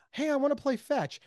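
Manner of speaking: high-pitched playful dog voice; eager dog. Acted. Dog asking its owner to play fetch, eager. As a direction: pipe up like a dog begging to play. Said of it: "your dog is like".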